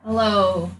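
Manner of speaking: ordinary talk, nothing marked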